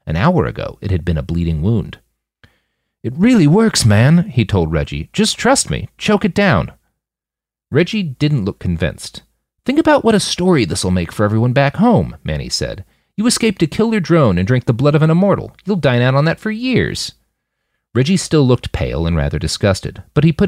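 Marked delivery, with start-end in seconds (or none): 3.0-6.7 put-on high, coaxing young man voice; urging. Manny urging Reggie. 9.7-17.1 higher, upbeat coaxing voice; persuading. Acted. Manny persuading.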